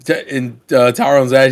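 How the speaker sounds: ordinary delivery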